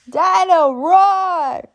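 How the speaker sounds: put-on high, slow toddler voice; toddler George. A narrator's reading. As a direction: say it like a delighted tiny boy.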